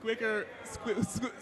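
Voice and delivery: laughing. Real, not acted. Stumbling over the book name.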